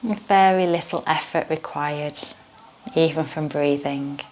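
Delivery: guided meditation. Acted. Leads yoga nidra meditation.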